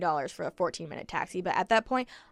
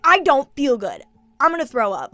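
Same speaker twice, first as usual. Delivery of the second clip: loud, raised-pitch re-enacted yell; retold yell; 5 semitones higher, 9 dB louder than usual. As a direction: yell it as you did to make him back off. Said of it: "yelled at him".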